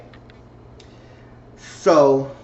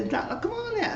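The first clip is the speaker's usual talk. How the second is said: much higher, softer protesting squeak; high mock character voice; 7 dB quieter than usual. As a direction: squeak out the denial, playing innocent. Voicing Charity denying affair.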